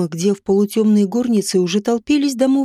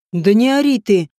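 a tall peasant snaps at the nanny; gruff rebuke; 3 dB louder than usual. A narrator's reading. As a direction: cut her off sharply. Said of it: "одёрнул няню высокий мужик".

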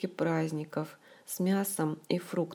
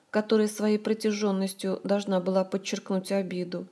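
plain reading voice

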